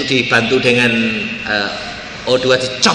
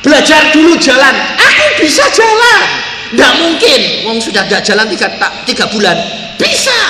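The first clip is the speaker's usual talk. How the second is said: much higher, louder quarrel; heated back-and-forth; 12 semitones higher, 9 dB louder than usual. Retold or imitated. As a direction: argue it out at full voice. Nurse and patient argue.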